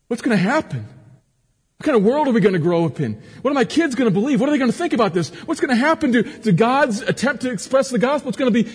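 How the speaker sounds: high, loud, fretful outcry; anxious panic. Acted. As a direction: act out a worried believer spiralling into panic. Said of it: "Anxious and angry. Infuriated and worried".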